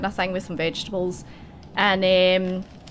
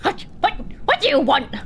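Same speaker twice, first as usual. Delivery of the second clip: snappy wife; 7 semitones higher than usual. Acted. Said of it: "wife answers the visitors".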